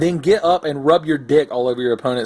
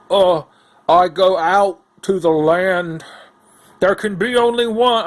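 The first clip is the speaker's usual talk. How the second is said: slow, higher dopey voice; dim outlander; 6 semitones higher, 4 dB louder than usual. Retold or imitated. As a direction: play him as clueless.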